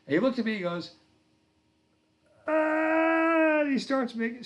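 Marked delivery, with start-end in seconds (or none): 2.5-3.6 put-on high, drawn-out toddler wail; mock child's crying. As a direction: imitate a small child bursting into tears. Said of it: "starts crying".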